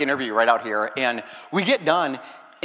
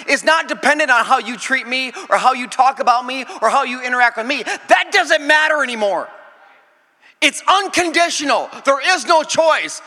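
raised, louder preaching voice, slower on key lines; full-force preaching; 9 semitones higher, 4 dB louder than usual. Acted. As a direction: preach it out to the congregation, building. Preaching about unconditional love.

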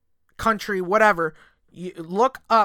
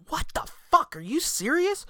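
mock disbelief; 9 semitones higher than usual. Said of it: "all sitting there going".

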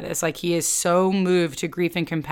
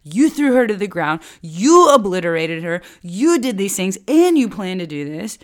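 loud, accusing outburst; raging at God; 5 dB louder than usual. Acted. Narrator joining Daughter Zion against God, raging. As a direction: hurl each accusation, leaning hard on YOU. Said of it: "in raging against God".

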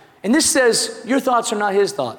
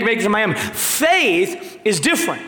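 usual speaking voice

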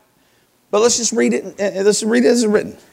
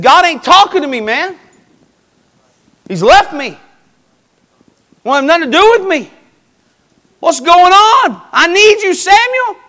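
high, loud acted pleading; desperate plea; 10 semitones higher, 6 dB louder than usual. Saul begging Samuel because God left him, desperate. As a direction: cry out for help, climbing higher toward the end.

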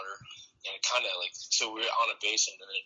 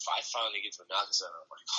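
ordinary talk

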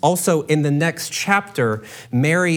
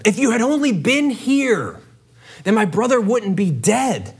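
raised, pleading lament to Jesus; grieving reproach; 6 semitones higher than usual. Acted. Mary at his feet blaming Jesus, pleading lament. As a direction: let the hurt spill out as she accuses him.

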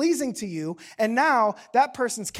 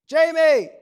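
louder, higher retold yell; calling out; 4 semitones higher, 8 dB louder than usual. Dad yells out to his daughter two rooms over, retold shout. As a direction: call a child from another room. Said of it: "I yell out to her".

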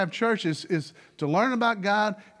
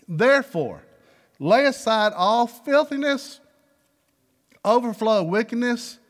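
louder, measured Bible reading; scripture reading; 3 semitones higher, 4 dB louder than usual. Acted. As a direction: read the verse out with weight. Reads scripture.

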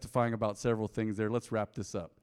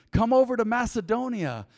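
high, raised pleading call; 10 semitones higher, 6 dB louder than usual. Acted. Macedonian man in Paul's vision begs for help, pleading call. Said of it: "pleading with him".

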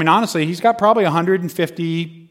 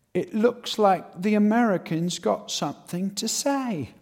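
higher, softer, slow mock-preacher voice; imitated street preacher's slow challenge; taunting preacher; 5 semitones higher, 7 dB quieter than usual. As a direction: draw it out to single out the heckler. Preacher turns on the American heckler.

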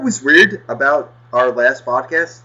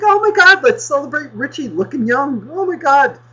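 high gushing mock voice, louder; mocking gushing clerks; 6 dB louder than usual. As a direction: squeal it like a fawning fan. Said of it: "Just being like".

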